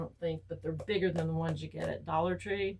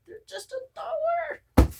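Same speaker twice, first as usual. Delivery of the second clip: much higher, louder and slow, mocking; mock squeaky exclaim; 9 dB louder, slower than usual. To husband about cheap notebooks, hammed-up high mock voice. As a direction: squeal it out like a cartoon voice.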